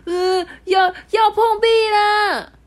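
very high, loud, slow scream; children on the train screaming in fright; kids screaming. Acted. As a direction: shriek like scared kids. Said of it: "全车的孩子都在尖叫说".

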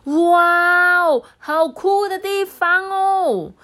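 very high, loud gasp of wonder; high, slow amazed exclaim; amazed wow. Acted. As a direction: marvel like awestruck kids. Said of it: "异口同声的说".

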